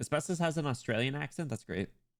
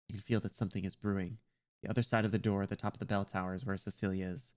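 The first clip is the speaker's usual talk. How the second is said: understated, hushed storyteller; story narration; 5 semitones lower, faster than usual. A narrator's reading. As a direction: narrate the suspense in a lowered voice. Reads the game's narration aloud.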